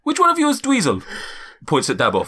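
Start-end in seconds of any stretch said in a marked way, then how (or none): 0.0-1.0 very high, bewildered giant; Zephyros. Acted. Giant still searching for a guest, very high accented voice. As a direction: ask in a high accented lilt. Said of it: "my accent's just all over the place".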